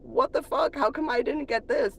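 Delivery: high, incredulous what the fuck; retold surprise. Vlogger retells seeing the delayed text, high and incredulous. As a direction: act out the shock at the late message. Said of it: "and I'm like".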